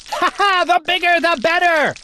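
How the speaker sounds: king laughing at the falling oobleck. Acted. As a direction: laugh it out greedily. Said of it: "laughed the king".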